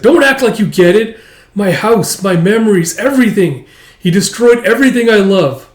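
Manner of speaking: loud, high, raging grief; girl beating Tao's back, screaming in grief; anguished shouting. Acted. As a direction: scream it while hitting his back. Beating her fists on Tao.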